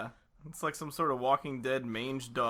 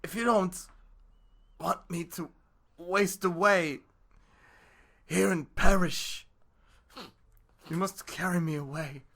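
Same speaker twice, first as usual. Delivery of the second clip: a louder, drawn-out whining plea; sly pleading fox; 4 semitones higher, 8 dB louder, slower than usual. Acted. The sly fox begs the wolf to carry him, hammed-up pleading. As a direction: lay it on thick, a fox begging for help.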